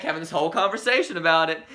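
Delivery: ordinary talk, nothing marked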